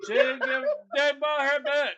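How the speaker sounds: high-pitched comic indignation; indignant. Real, not acted. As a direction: complain in exasperated disbelief, pitch up. Indignant at her not caring.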